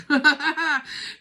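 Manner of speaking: loud, high real laugh; burst of laughter. Real, not acted. Reader laughing at freaking people out. Laughs before voicing candle.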